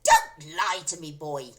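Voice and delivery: put-on loud, harsh old hag; hag shouting. A narrator's reading. Grandma shouting at George. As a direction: bark the order down. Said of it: "the enormous old hag shouted".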